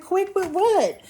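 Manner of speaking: high, loud, sharp comeback of disbelief; incredulous retort. Retold or imitated. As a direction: fire back as if the remark made no sense. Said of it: "I'm like".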